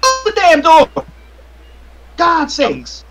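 high falsetto scolding; hammed-up outraged woman's voice; angry woman on a toilet. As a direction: squawk indignantly at the intruder. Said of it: "startle a, a woman on a toilet".

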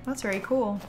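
ordinary talk

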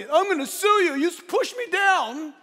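high, loud put-on indignation; mock outraged victim. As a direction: play the aggrieved complainer, hammed up. Acted outraged rescued person threatening suit.

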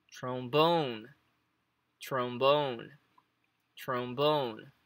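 trombone modelled with the final BONE stressed. Shown as a demonstration. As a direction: repeat trombone, leaning on BONE each time. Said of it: "A entonação vai entrar aqui no bone". (trombone com a tônica no bone)

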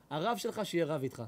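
ordinary delivery

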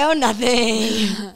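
slow, drawn-out "nothing"; retold sarcasm, playing it off; sarcastic. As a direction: stretch it out as if brushing it off, mock casual.

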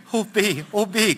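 high-pitched wifely moan; wailing lament. A narrator's reading. Wife despairing beside her husband, high wailing lament. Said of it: "klagte meine Frau".

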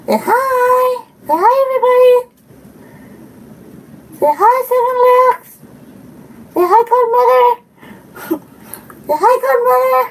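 dog talk. Real, not acted. Making dog greet viewers.

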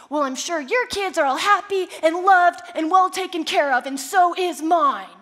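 loud, pointed jab; a mock-sweet dig delivered loud; cutting retort. Mom getting the last word in the parking lot, loud and pointed. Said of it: "finally I say".

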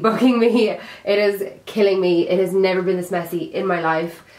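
usual speaking voice

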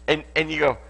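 normal talking voice

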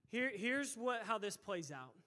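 ordinary delivery

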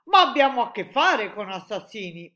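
loud, indignant objection; protest. A narrator's reading. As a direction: object forcefully, well above talking volume. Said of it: "protestò il capo dell'esercito". (protesta ad alta voce)

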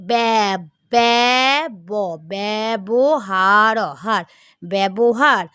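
ব্যবহার drawn out very slowly, syllable by syllable, then said whole. Shown as a demonstration. Teacher showing a class how to say ব্যবহার, sounding it out very slowly. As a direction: stretch out ব্যবহার slowly so each part of it is heard. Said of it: "বয় জফলা আমরা কি বলবো".

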